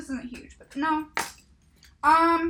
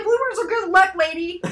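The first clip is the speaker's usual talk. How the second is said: raised, louder mock argument; retold retort; 4 semitones higher, 7 dB louder than usual. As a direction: act out a bird arguing back. Acts out the bird's retort.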